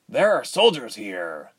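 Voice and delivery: mildly nervous, slow warning. A narrator's reading. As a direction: break the bad news slowly, a touch nervous. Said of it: "he said nervously".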